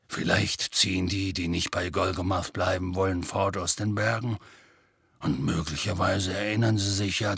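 gruff gentle giant telling bad news; sad half-giant. A narrator's reading. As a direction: speak like big sad Hagrid. Hagrid voiced in story.

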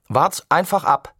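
mild mock shout; shouting boy. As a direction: yell it as you swing up. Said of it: "schrie er".

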